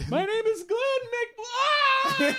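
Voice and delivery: very high, slow, crying his full name; sobbing victim voice. Pretend. Glenn giving his last name before the vampire, mock sobbing. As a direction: whimper it like a doomed extra.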